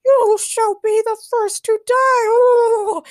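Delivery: very high, loud squeaky threat; shouting turkey leader. A narrator's reading. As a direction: screech a villain's death threat. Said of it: "the turkey leader shouted".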